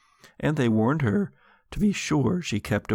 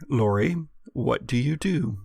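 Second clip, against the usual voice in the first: ordinary talk, nothing marked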